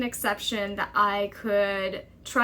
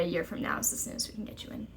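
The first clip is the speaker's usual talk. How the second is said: quieter and fast, a bored flat repeat; flat repeated refusal; 4 semitones lower, 8 dB quieter, much faster than usual. Retold or imitated. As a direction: rattle it off like a tired receptionist. Receptionist refusal repeated flatly.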